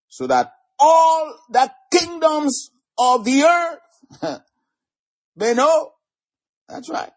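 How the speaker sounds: high, slow, drawn-out declamation; preacher's ringing prayer, stretched word by word; challenging prayer. Shown as a demonstration. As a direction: cry it out to heaven as a challenge. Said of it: "when you say it like that, you're kind of challenging the Lord".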